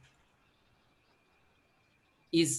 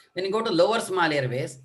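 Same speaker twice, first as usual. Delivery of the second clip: ordinary talk, nothing marked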